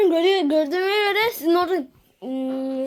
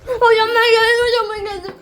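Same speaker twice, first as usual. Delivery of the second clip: calling out; 6 semitones higher, 5 dB louder than usual. Acted. Calling out to the coach.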